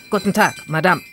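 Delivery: lightly voiced policeman; a touch of official firmness; policeman's voice. A narrator's reading. Policeman greeting the shop lady, lightly official. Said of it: "die Stimme eines Polizisten".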